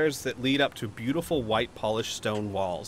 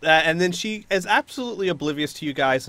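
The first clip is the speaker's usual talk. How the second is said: usual speaking voice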